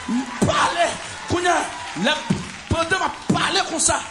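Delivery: loud, high, shouting testimony with bursts of laughter; fervent preaching. Acted. Telling the congregation of the vision, shouting in fervour. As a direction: preach it out at full force to the church.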